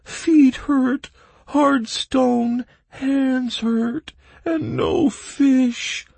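very high, loud whine; small complaining voice. A narrator's reading. Bog creature in the darkness, complaining shrilly. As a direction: complain in a tiny piping voice. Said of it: "complained the small voice".

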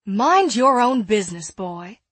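loud, sharp snap; snapping. Acted. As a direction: bite the words off. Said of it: "Eliza snapped".

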